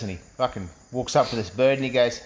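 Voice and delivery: ordinary talk, nothing marked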